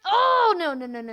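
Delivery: high, loud real cry of dismay; alarmed cry. Real, not acted. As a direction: cry out as it goes wrong. Car race suddenly going wrong.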